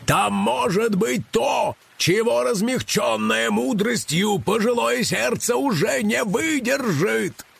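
high, slow dramatic cry; shouting. A narrator's reading. Karych shouting through thunder and wind, impassioned. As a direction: cry it out grandly over a storm. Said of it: "Карыч кричал".

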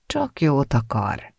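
slow, soothing, mild; calm her down gently, slowly; conciliatory. A narrator's reading. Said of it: "mondta az apja békülékenyen". (lassan, békülékenyen)

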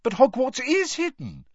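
very high, loud astonishment; surprised. A narrator's reading. Girl amazed friend doesn't know, surprised. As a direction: blurt it in disbelief. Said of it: "said Hermione in surprise".

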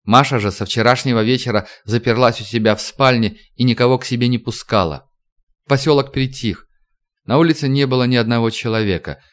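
ordinary delivery, nothing marked

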